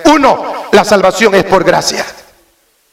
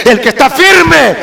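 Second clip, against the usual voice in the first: fiery preaching; 5 semitones higher than usual. Acted. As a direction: preach it out to the back pews. Preaching at full force.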